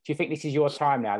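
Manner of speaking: ordinary talk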